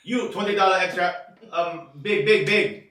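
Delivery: high, loud mock tailor in broken English. Friend retelling the tailor's charge, loud hammed-up mimic.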